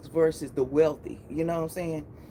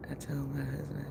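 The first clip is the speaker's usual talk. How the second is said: a real whisper, no voice; 4 semitones lower, 11 dB quieter than usual. Real, not acted. Hushed whisper to viewers. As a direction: she whispers aside to her chat, I told my husband. Aside while reading live chat.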